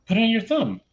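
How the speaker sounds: imitated kid giving instructions, pitched high; insistent student. Student tells teacher how to test prototype, insistent. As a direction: pipe up like a kid directing the teacher.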